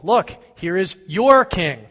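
mocking announcement, slow and loud; loud presentation. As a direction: present him to the crowd with bite. Pilate presenting Jesus.

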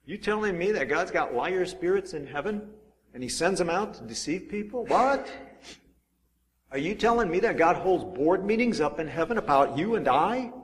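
high-pitched imitation of troubled disbelief; shocked, bothered reader. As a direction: play the scandalized Bible reader, questioning in rising pitch. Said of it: "People who read that Bible verse are very bothered by it".

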